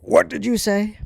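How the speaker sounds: a sudden high, loud shout of anger; furious yell. Retold or imitated. Father shouting at his son over short sleeves, furious yell. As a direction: snap back at him in a rage. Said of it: "he just got so pissed".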